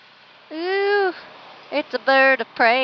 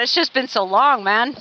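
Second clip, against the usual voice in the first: ordinary talk, nothing marked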